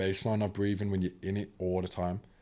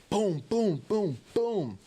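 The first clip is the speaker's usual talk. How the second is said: high, loud boom boom like fists hitting; punching sound effect; 13 semitones higher, 4 dB louder, much slower than usual. Retold or imitated. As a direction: mimic each punch as a sharp boom. Punching the chair in anger.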